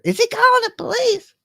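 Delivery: very high, panicky squeak in imitation; put-on high-pitched scaredy-cat voice; mock scared. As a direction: mimic a frightened would-be tough guy. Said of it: "go to people's houses scared".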